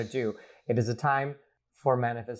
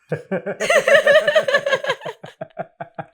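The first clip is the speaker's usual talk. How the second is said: loud high laugh; 11 dB louder than usual. Real, not acted. Big laugh after impression.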